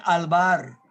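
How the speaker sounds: Alvar with the final o dropped; Alvar, the name cut off at the end; Canadian mispronunciation. Retold or imitated. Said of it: "no podían pronunciar mi nombre".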